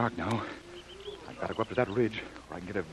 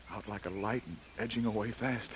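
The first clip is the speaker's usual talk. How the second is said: normal talking voice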